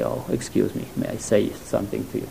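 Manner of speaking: soft, hushed mock politeness; overly polite. Warning a neighbor near a mine, meekly polite. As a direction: imitate a meek, apologetic interruption. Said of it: "we wouldn't say".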